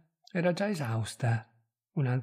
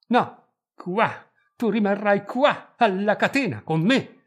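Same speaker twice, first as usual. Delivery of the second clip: high, loud, forceful command; angry shouted insistence; domineering anger; 5 semitones higher, 6 dB louder than usual. A narrator's reading. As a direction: pin the listener down with the words. Husband forces wife to stay.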